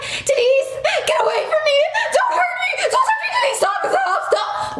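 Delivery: very high hammed-up shrieking with laughter; mocking her fake screams. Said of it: "And she's like".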